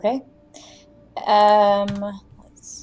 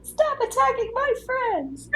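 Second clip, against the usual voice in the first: squeaky yell; 4 dB quieter than usual. Pretend. Character yells at enemy, squeaky.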